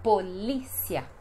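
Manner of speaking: "polícia" slowly, po-LI-sia; stress on LI. Shown as a demonstration. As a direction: lean on LÍ in "polícia". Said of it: "mais forte é no li".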